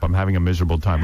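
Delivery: low, quick imitation of a moaner; mock complaining. Host imitating the guest griping to the hostess, mock miserable.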